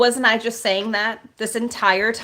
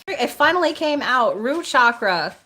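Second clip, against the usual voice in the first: high, loud, thrilled; excited announcement; 5 semitones higher, 5 dB louder than usual. Real, not acted. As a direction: burst out with the long-awaited news. Awaited card finally turns up.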